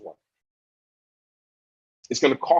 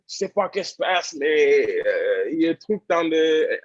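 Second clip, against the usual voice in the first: flustered French, pitched up and faltering; freaking out; 4 semitones higher, slower than usual. Retold or imitated. Player calls his agent in a panic, freaking out. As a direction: stumble through French as if too emotional to find words. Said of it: "I'm freaking out".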